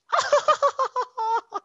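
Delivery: very high squealing giggle; high girly giggle. Retold or imitated. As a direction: giggle like the cute girl at her own joke. Girl's laugh at own joke.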